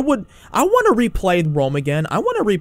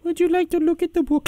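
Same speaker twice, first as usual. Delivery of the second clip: high, chirpy put-on voice; interrupting book pusher; 11 semitones higher, 4 dB quieter than usual. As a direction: butt in with a high singsong offer. Repeated interrupting catchphrase.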